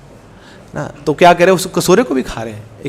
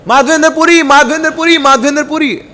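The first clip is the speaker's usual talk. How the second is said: calling the name high across the market; 8 semitones higher than usual. Acted. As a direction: shout the name over a busy bazaar, pitched high. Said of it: "जोर जोर से चिल्ला रहा है".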